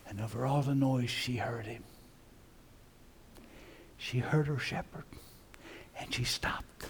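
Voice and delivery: very soft, near whisper; hushed storytelling. Acted. Preacher telling how the sheep heard her shepherd, hushed. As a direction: drop to a hush for the wonder of it. Hushed moment after the yell.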